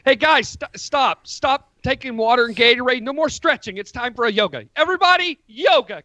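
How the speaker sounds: rally the team like a pushy trainer; eager trainer calling out. Acted. Acted trainer halting the team.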